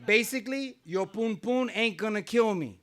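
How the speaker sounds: ordinary delivery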